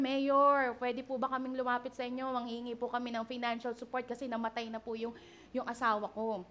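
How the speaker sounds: quick, softened, deferential request; petitioner to the mayor. Acted. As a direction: humbly ask an official for help. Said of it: "lumalapit tayo kay Mayor".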